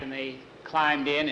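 ordinary delivery

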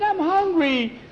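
put-on high, whiny little boy; whiny hungry boy. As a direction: whine like a starving kid. Boy whining inside trunk.

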